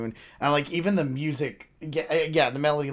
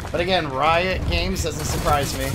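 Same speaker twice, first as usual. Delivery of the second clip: excited; 2 semitones higher, 6 dB louder than usual. Real, not acted. Awed by animation.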